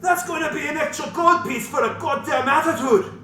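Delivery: a gruff tavern keeper snapping at customers; loud, indignant innkeeper; gruff barkeep. Acted. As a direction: barkeep Durnan griping about payment. DM voices tavern keeper Durnan.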